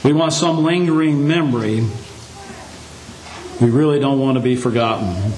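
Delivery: usual speaking voice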